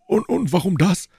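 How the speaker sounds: high-pitched, stumbling question; flustered Porthos. Acted. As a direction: play the puffed-up gentleman, caught off guard. Voices boastful Porthos. (verdutzt, mit hoher Stimme)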